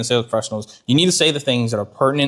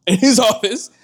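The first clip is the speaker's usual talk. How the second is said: laughing mock confrontation; 6 semitones higher than usual. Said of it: "I'd be like".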